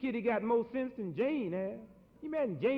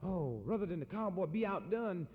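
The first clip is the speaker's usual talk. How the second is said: normal talking voice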